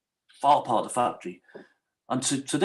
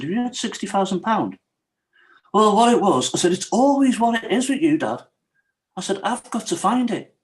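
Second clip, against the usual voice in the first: high, raised demand; confronting dad, raised high and loud; angry confronting dad; 7 semitones higher, 4 dB louder than usual. Retold or imitated. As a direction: pin your dad down, voice up high. Confronting dad about sixty thousand.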